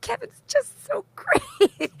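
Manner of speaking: imitating a high, slow, teary crack; voice breaking up. Daughter's toast about Kevin, imitated teary high voice. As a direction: squeeze it out high and slow, near tears. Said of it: "and her voice goes up".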